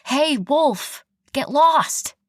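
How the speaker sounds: high, loud yell at a wolf. Retold or imitated. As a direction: shout to scare it off. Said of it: "and I yelled something like".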